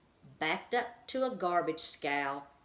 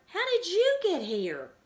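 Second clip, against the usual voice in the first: high, loud, astonished question; amazed delight; 13 semitones higher, 8 dB louder than usual. A narrator's reading. Stuart asks the bird how she got here, amazed and loud. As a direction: burst out in wonder. Stuart's joyful surprise at Margalo.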